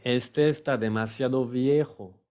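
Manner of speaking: ordinary talk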